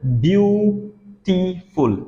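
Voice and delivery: Beautiful in three separated beats, byu, tee, ful; split into syllables. Shown as a demonstration. Teacher showing students three sounds in a word, beautiful said syllable by syllable. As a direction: pause between each syllable of beautiful. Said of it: "तीन आवाजें हैं".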